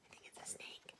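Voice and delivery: breathy hushed guess; whisper. Real, not acted. Teacher whispering her guess to the children. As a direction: whisper it conspiratorially.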